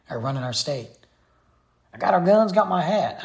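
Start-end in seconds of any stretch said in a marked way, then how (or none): 1.9-3.2 loud, higher gun-slinging cartoon cowboy; Yosemite Sam. Vlogger mocking the state government as a loud cartoon cowboy. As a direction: holler it like a cartoon outlaw. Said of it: "I feel like Yosemite Sam's down here".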